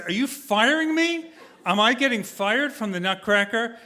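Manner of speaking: disbelief. Retold or imitated. Couldn't believe it.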